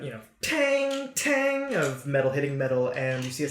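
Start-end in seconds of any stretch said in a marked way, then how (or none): 0.4-1.7 high, drawn-out "tang"; imitated hammer clang. Game master imitates the blacksmith's hammer, high ringing clang. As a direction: ring out like metal on metal. You hear the.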